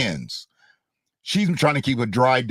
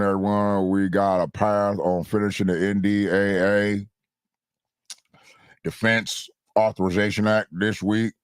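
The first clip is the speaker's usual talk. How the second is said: put-on low mumbling old senator; Mitch McConnell impression; 6 semitones lower than usual. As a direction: mumble it like a mouthful. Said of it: "sound like I got a mouthful of shit".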